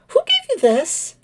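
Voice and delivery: high, slow, suspicious chirp; high suspicious bird voice; suspicious bird lady. Acted. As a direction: demand it with narrowed suspicion. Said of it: "holds up the cigarette".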